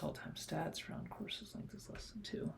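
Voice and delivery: whispered, reading code to himself; whispering. Real, not acted. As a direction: murmur it under your breath. Reading code under breath.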